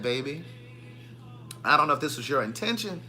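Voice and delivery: low, hushed imitation of a confession; lightly imitated whisper, mostly low and soft; retold confiding whisper. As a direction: lean in, softly voicing her secret. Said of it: "she whispered in my ear".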